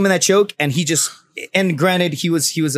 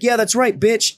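mocking sneer, raised pitch; taunting thug; 7 semitones higher, slower than usual. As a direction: taunt him like a street tough. Attacker taunting.

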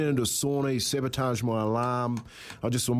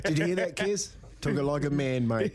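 mock pride; 3 semitones higher than usual. Mocking colleague's praise.

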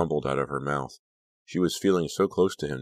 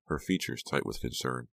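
plain reading voice

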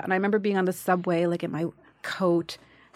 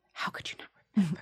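quick, whispered disbelief; mock-wounded whispered question; hurt whisper; 7 dB quieter, much faster than usual. Hurt reply to David Chase, retold.

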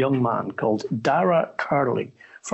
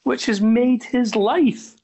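mock indignant; 9 semitones higher than usual. Real, not acted. Rebuking co-host's cynicism.

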